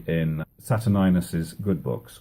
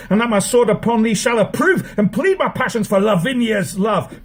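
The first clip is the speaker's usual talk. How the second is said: put-on quarrelling young prince; 11 semitones higher than usual.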